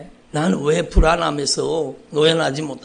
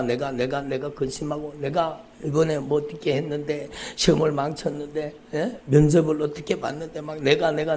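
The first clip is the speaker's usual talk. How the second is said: ordinary talk, nothing marked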